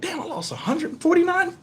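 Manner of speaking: high, hushed mock shock; quiet, high-pitched incredulous retelling; retold disbelief. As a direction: squeak it softly, as if reading a shocking number. Retells finding lost subscribers.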